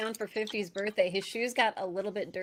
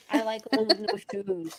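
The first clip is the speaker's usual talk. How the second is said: ordinary talk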